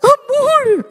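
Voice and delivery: high, whimpering mock plea; crying wife calls to her husband, hammed-up tearful whimper. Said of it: "la esposa llora".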